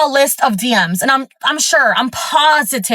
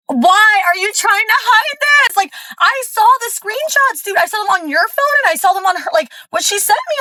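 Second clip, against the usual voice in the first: exasperated confrontation; 10 semitones higher than usual. Retold or imitated. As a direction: confront a liar in a high, incredulous wail. Retold confrontation with cheating partner.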